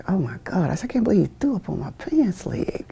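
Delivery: hushed, unvoiced aside; whisper. Recruit whispers about the sick guy on the plane, hushed. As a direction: whisper it in disbelief.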